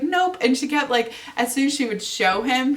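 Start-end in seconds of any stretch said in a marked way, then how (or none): 0.0-0.4 put-on high, sharp little kid nope; mock toddler saying nope; toddler refusal. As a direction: snap it like a cheeky three-year-old. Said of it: "she's like".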